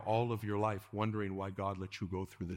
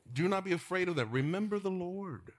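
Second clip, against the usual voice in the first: high, loud rising exhortation; fervent preaching; 8 semitones higher, 3 dB louder than usual. Acted. Preacher urges the congregation not to fear, fervently. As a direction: lift it to a fervent cry.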